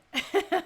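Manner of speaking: loud bright laugh; burst of laughter. Real, not acted. Host laughing at the NBA news. Laughs at her own confusion.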